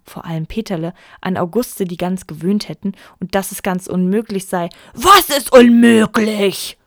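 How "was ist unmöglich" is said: loud, slow, incredulous demand; indignant question. A narrator's reading. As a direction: bellow the question in disbelief. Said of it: "fragte Vater Löwenhaupt". (laut und empört)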